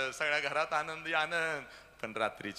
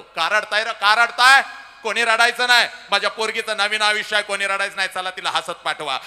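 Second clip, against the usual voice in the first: high, loud commanding shout; a father ordering everyone not to cry; father shouting at guests; 9 semitones higher, 15 dB louder than usual. Retold or imitated. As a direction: bellow at the whole hall to stop crying. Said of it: "सगळ्याला ओरडतो".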